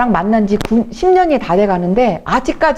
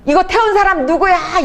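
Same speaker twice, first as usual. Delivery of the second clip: mock scolding; 8 semitones higher than usual. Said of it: "이러는 거예요".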